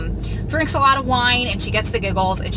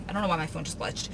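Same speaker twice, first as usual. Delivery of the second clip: normal talking voice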